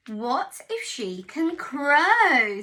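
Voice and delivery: lightly voiced rooster, worried; anxious farmyard rooster. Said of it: "said the rooster".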